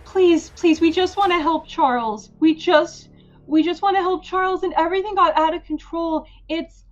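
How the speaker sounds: high, loud, breathless begging; panicked pleading. Acted. Cuffed girl confessing to Robin, gasping and pleading. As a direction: gasp out a desperate confession. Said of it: "as she's gasping for breath".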